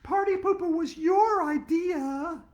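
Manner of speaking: high, loud, slow blaming yell; angry accusation. Acted. Andrea blaming Arlo for the idea, loud slow accusing yell. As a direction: jab each word of blame. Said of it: "Andrea yelled".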